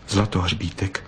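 usual speaking voice

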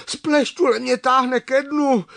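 high, loud alarmed call; calling for help. A narrator's reading. Čmelda tells of being dragged to the bottom, calling. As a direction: shout it up high as you sink.

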